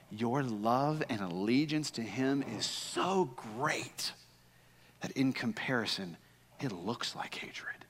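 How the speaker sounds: slow, hushed explanation; softly unpacking the hard saying. Real, not acted. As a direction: ease into a whisper by the end. Explaining Jesus' meaning intimately.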